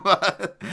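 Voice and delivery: sudden loud burst of laughter; cracking up at himself, loud and high. Real, not acted. Host laughs at his own accent, loud and high.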